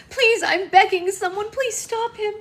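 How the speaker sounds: full-voiced desperate begging; hysterical pleading. A narrator's reading. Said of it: "Red cried hysterically".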